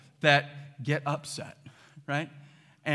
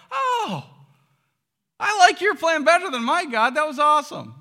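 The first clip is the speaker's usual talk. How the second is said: much higher, louder gushing delight; delighted realization; 11 semitones higher, 8 dB louder than usual. Acted. Believer looking back at God's plan, gushing with delight. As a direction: marvel at it with happy surprise. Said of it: "we're like".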